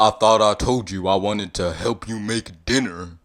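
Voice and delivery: loud, put-on mock scolding; playfully angry. You chiding Shrek about dinner, playfully angry. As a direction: scold a sweetheart in play, loudly. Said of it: "with a playfully angry face".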